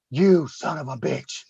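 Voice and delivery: low, slow, quiet curse; dawning resentment. Retold or imitated. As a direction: curse him slowly under your voice. Realizing opponent's plan in story.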